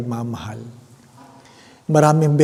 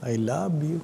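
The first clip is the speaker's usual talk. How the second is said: soft, self-conscious practice murmur; shy rehearsing while walking; quiet rehearsal; 2 semitones higher, 8 dB quieter than usual. Shown as a demonstration. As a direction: try the words out under your breath. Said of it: "Kunwari, para makapag-practice".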